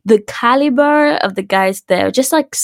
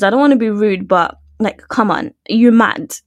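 ordinary talk

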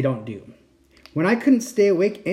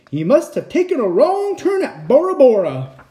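high, loud pirate captain; 9 semitones higher, 6 dB louder than usual. Acted. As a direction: bluster like an old sea dog. Pirate musing.

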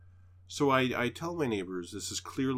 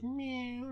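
much higher mock meow; cat meow. The cat answering at the sidewalk, high mock meow. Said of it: "the cat goes".

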